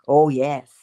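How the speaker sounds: ordinary delivery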